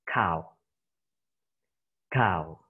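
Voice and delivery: "Cow" said slowly and clearly as /kaʊ/; careful teacher demo of "Cow"; model pronunciation. Shown as a demonstration. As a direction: model "Cow" for the class to copy. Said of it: "and you read it".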